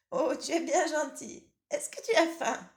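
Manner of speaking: high, loud impatient granny voice; old grandmother. Acted. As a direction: nag like a grumpy old granny.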